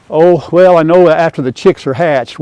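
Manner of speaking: ordinary delivery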